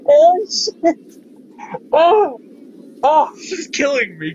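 high, loud laughter spilling into the words; laughing fit. Real, not acted. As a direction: crack up and barely get the words out. Breaking down laughing while playing.

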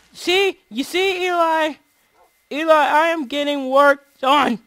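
loud, high, drawn-out protest; hammed-up insisting, words stretched; mock indignant. Player boasting to Eli of work done, mock indignant.

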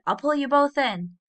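contained storybook shout to the rescue. A narrator's reading. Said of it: "he shouted".

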